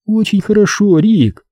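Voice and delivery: high-pitched compliant woman; meek female character voice; submissive. A narrator's reading. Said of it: "покорно ответила она".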